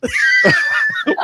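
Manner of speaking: squealing burst of laughter; high shrieking laugh. Real, not acted. Reacting to joke.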